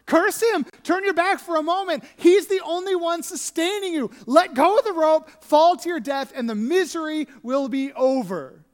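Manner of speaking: loud, high, goading wife's voice; taunting wife. Retold or imitated. Wife urging Job to curse God, loud and goading.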